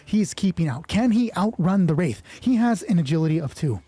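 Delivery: ordinary talk, nothing marked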